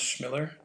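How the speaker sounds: Schmiller said as SHMILL-er, short i; Schmiller, stressed on the first syllable; demonstrated pronunciation. Shown as a demonstration. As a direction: say Schmiller clearly with an initial SH, rhyming with Miller. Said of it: "how to pronounce your last name".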